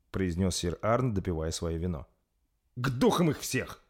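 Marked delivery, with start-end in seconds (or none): 2.8-3.8 mild, higher-pitched curse; cursing. A narrator's reading. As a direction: swear in irritation. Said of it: "выругался Рольф". (ругнувшись, с досадой)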